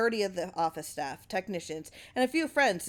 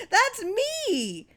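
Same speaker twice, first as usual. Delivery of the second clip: very high, louder outraged shriek; shrieking outrage; 6 dB louder than usual. Acted. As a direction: shriek in furious recognition. Clarence recognizing himself, furious.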